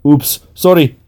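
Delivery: a quick high "sorry" for intruding; embarrassed apology. A narrator's reading. Masseuse backing out after walking in on client, embarrassed apology. Masseuse peeks in, shuts curtain.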